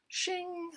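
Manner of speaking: high, quiet metallic shing; blade shing sound. Retold or imitated. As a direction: imitate a bayonet being drawn. Bayonet sound effect.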